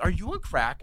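high-pitched mock disbelief; incredulous retort. Speaker answering his friend's silly suggestion, incredulous. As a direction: squeak it out as an exasperated comeback.

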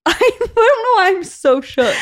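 high, slow laughing quote. Retold or imitated. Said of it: "I was like".

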